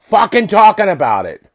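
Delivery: loud, high outburst of frustration; exasperated yell. Real, not acted. Speaker fed up with the same old talk, exasperated yell.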